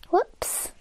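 breathy unvoiced whoops; whispered whoops. Real, not acted. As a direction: whisper it under your breath. Mistake in the game.

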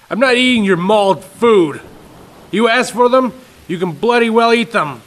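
loud, high scolding; angry father. Acted. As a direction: scold the boy hotly.

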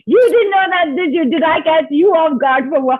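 playful teasing. Real, not acted. Host teasing the teacher about the blue streak, excited. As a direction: poke fun at her, bubbling over.

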